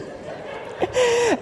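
soft high burst of laughter. Real, not acted. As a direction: laugh at the memory. Laughter after the exchange.

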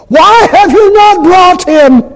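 high, loud, slow demand from the Pharisee; angry Pharisee. Acted. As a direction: bellow it like an indignant Pharisee. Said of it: "they said".

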